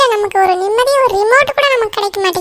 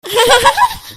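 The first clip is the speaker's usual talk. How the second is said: loud mocking laugh; 2 semitones higher, 5 dB louder than usual. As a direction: laugh out at the fooled little sister. Siblings laugh at fooled girl.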